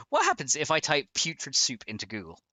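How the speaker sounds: normal talking voice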